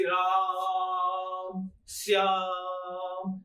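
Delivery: राम and श्याम with the आ stretched very long and slow; राम, श्याम called out with long held vowels; drawn-out pluta vowels. Shown as a demonstration. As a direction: hold the आ in राम and श्याम, then let it ring. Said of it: "और लुप्त जो".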